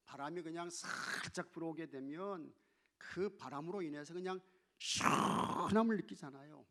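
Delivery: usual speaking voice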